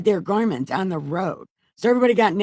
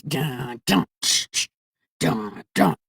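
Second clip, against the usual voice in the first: [name] intro; 4 semitones lower, slower than usual. Retold or imitated. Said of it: "all you hear is [name]'s".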